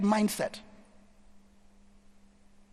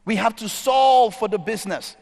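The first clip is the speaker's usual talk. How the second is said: "solve" stretched loud and higher; stress on solve; 4 semitones higher, 6 dB louder than usual. Shown as a demonstration. Speaker argues products serve the business, stressing "solve". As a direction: punch and hold the word "solve".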